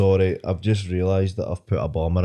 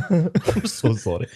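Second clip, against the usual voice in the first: laughing echo; 3 semitones higher, much slower than usual. Retold or imitated. Teasing the comic over his retold apology, laughing.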